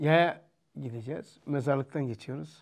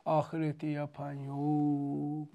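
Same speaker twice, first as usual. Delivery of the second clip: slow lament; 4 dB quieter, much slower than usual. Retold or imitated. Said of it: "Böyle yapardı".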